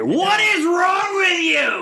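high, slow outraged yell; outraged shout. Pretend. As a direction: stretch it out in mock outrage. Mock outrage at host.

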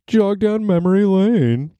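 high, sustained sing-song; playful high-pitched lilt; hammed-up cliché. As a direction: stretch it out in a silly high voice. Hams up a cliché phrase.

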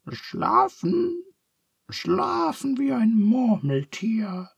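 very high squeaky character voice; grunting Charles. A narrator's reading. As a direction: squeak it out in a tiny falsetto. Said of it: "grunzt Charles".

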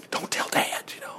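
secretive whisper. Pretend. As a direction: hush it so Dad won't hear. Brothers hiding it from father.

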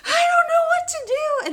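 very high, loud mock panic; hyperventilating panic. Retelling the panic to friends on speakerphone, hyperventilating. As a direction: squeal it as if hyperventilating. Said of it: "I'm just hyperventilating".

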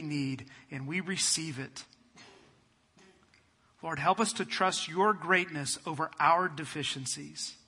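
hushed reverent prayer; closing prayer. Real, not acted. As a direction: pray gently over the congregation.

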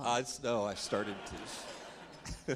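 slow, drawn out, laughing through it; laughing admission. Real, not acted. To the audience about nearly crying, slow and laughing. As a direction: laugh it off as you admit it.

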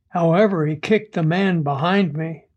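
usual speaking voice

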